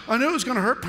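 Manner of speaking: the victim who saw it coming; mock whine. Deer hit, complaining.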